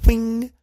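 high, soft "whing"; a thin little whistling noise; imitated whistle. A narrator's reading. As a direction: make a magical zip sound, high and soft. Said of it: "and a whistle".

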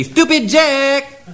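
drawn-out high yell of the name; long yell. Acted. Man with a pig yelling at Jack, drawn-out high call. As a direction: stretch the name out as if yelling it into his head. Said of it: "people think if they yell real loud".

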